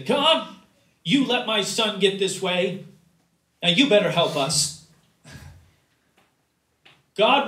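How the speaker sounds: loud, high, accusing demand of God; entitled, angry complaint; demanding prayer. Pretend. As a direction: scold God as if he owes you. Mock entitled prayer demanding help.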